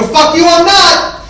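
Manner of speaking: high, screamed comeback; furious refusal. Retold or imitated. Mike refusing his brother a ride, screaming back furiously. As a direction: yell back at full volume.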